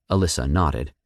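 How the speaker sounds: usual speaking voice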